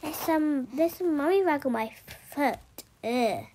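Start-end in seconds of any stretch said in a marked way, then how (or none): none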